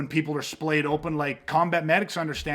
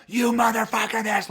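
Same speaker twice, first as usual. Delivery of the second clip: retold scream; 5 semitones higher than usual.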